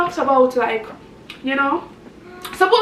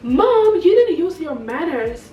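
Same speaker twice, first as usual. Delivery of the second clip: put-on high, loud indignant child telling off mom; mock-indignant kid voice; scolding little girl; 4 semitones higher, 4 dB louder, slower than usual. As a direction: imitate a child's reproach, pitch leaping on "Mom". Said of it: "and she was like".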